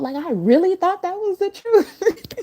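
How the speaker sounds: real giggle through the words; laughing. Real, not acted. Explaining her message, breaking into laughter.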